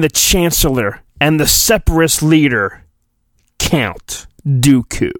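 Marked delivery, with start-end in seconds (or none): none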